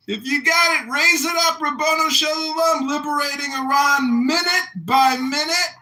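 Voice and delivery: high, loud rally cry; rousing shout. Real, not acted. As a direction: rouse the room to raise a glass. Toast, raise your glass.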